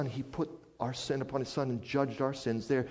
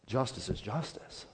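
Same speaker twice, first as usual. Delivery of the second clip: hushed officer; 2 semitones lower, 6 dB quieter than usual. Retold or imitated. Acted officer repeats the verdict.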